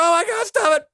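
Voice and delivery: high, strained, desperate begging; pained pleading. Pretend. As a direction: beg them to stop, in pain. Acting out being tortured.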